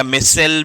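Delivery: मिसेल with short "mi", then "sell"; alternative pronunciation मिसेल. Shown as a demonstration. Teacher giving students the variant some people use, pronounced मिसेल. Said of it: "भी बोल देते हैं कुछ लोग".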